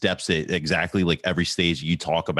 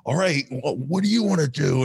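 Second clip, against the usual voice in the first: put-on high, challenging kid's taunt; squaring up; 9 semitones higher than usual. A boy daring a classmate in science class, high and challenging. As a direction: puff up and dare him.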